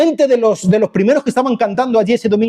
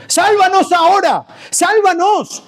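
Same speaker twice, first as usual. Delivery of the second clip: high pleading cry; urgent plea; 11 semitones higher than usual. Acted. Preacher acting out a people begging to be saved, urgent plea. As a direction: cry out for rescue on a high pitch.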